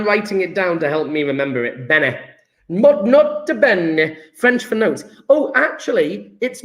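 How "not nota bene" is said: slow, stretched Italian accent; hammed-up Italian accent. Shown as a demonstration. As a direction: draw it out like a mock Italian. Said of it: "I'm saying it with an Italian accent".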